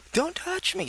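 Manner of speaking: squeaky high mock prissy voice; mock touchy girl. Mocking a touchy woman who snaps at strangers.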